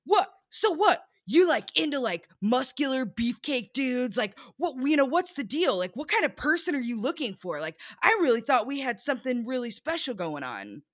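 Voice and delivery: high, excited imitation of the date; animated mock date. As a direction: mimic him, pitched high and agitated. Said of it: "Very animated".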